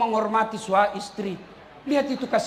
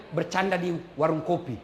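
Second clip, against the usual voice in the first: ordinary delivery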